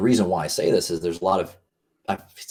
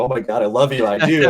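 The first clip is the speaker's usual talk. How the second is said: mock wedding rapture; 6 semitones higher, 9 dB louder than usual. Voicing a groom at the wedding.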